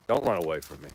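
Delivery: ordinary talk, nothing marked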